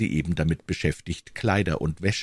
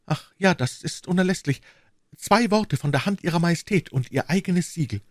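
put-on higher-pitched earnest young woman; devoted young servant woman; 12 semitones higher than usual. Young servant pledges herself to her queen, earnest and devoted.

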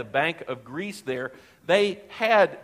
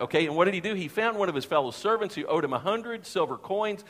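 normal talking voice

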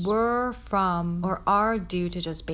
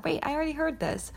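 surprised realisation; 8 semitones higher, faster than usual. Retold or imitated. Quoting own realisation.

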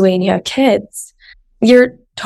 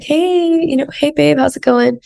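higher, bright imitation of Ben saying hi; cheery husband; 7 semitones higher than usual. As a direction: greet her like a happy boyfriend. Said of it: "he'll be like".

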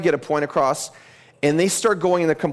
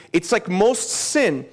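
ordinary talk, nothing marked

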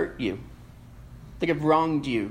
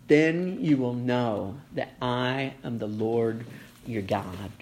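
Then you will know said slow and hushed, dying away on your God; slow, soft reverent repeat; 6 semitones lower, 5 dB quieter than usual. Real, not acted. Preacher repeating God's promise to the church, slow and hushed. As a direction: linger on each word, softer and softer. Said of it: "repeats key verse slowly".

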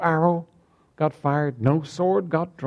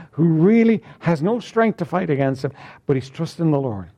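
ordinary talk

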